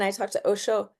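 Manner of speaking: ordinary delivery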